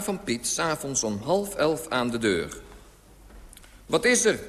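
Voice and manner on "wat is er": high, loud call from a window; raised voice, pitched up to carry. A narrator's reading. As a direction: shout the question down to the street. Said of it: "riep ik uit het raam van één hoog naar beneden".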